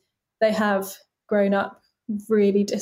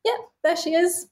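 cheerful, proud; 7 semitones higher than usual. Retold or imitated. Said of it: "And now I'm like".

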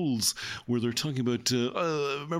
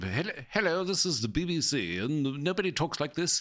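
put-on higher-pitched old-time BBC announcer; mock received pronunciation, old radio style; old BBC accent; 4 semitones higher than usual. BBC announcer back in the day, received pronunciation. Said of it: "the B B C back in the day. They had that sort of".

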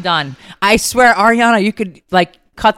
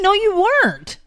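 much higher, disbelieving "No, you weren't."; incredulous; 13 semitones higher than usual. Real, not acted. Mom disputing daughter's hair claim.

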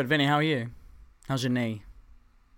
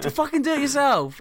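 much higher, louder mock outburst; exasperated retort; 13 semitones higher, 5 dB louder than usual. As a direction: snap back at a nagging mate. Refusing friend's pestering.

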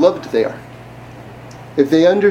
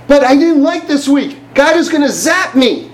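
much higher-pitched, acted-out alarm; mock panic; 8 semitones higher than usual. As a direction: voice someone fretting about divine punishment. Voices a person fearing God's punishment.

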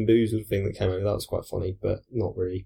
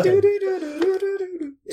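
squeaky high voice. Pretend. Squeaky reply to "which one.

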